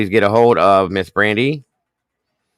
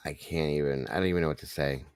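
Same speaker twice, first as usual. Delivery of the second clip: much softer, choked up; overwhelmed hush; 5 semitones lower, 12 dB quieter, faster than usual. Real, not acted. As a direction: barely get the words out. Overwhelmed, near tears.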